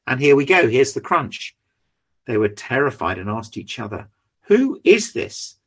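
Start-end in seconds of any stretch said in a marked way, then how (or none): 4.5-5.6 high, slow, awestruck "who is this"; awed fear. Acted. Terrified disciples to each other, high and slow. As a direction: ask it slowly in a high awed voice.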